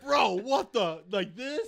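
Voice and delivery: high, slow, laughing bro outrage; loud laughing mock bro. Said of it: "Be like".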